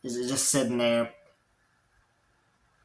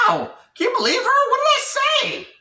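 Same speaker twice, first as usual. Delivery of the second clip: very high, loud squealing protest; mock outraged yell; 6 dB louder than usual. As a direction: cry out in hammed-up hurt and disbelief. Acted outcry after being whipped.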